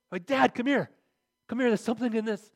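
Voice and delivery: high, loud excited shout to dad; an eager kid calling his father over. Retold or imitated. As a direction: yell for dad, thrilled at the catch.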